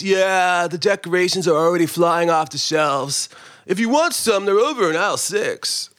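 creaky vocal fry employee voice; lazy creaky-voiced clerk; vocal fry guy. Shown as a demonstration. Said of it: "That's my, um, what do they call it? Vocal fry".

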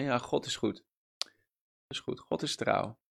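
soft, offhand, half-hearted repeat; mock empty cliché. Preacher mocks believers repeating a cliché, soft and offhand. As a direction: toss it off like a worn slogan. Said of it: "Kunnen heel snel clichés worden".

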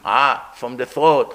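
Ah pronounced deep from the throat, slow; a throaty Ah, squeezed from deep in the throat; guttural Ayin. Shown as a demonstration. Rabbi demonstrates Ayin to students: deep throaty Ah. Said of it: "you have to pronounce Ayin".

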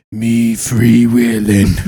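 slow, growled demon voice. Acted. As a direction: drag it out like a demon. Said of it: "in that same de-, demonic voice".

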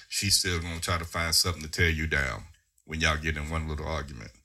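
ordinary delivery

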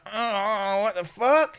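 puzzled that the body is gone; confused surprise. Retold or imitated. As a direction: lizard man finding the blood cleaned up, baffled. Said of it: "the lizard man is like".

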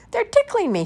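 high, loud tickled squeal; laughing, tickled. A narrator's reading. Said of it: "he laughs".

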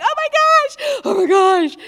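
mock flustered squeal; giddy panic. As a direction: squeal in nervous excitement. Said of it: "I was like".

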